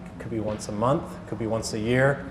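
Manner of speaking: ordinary talk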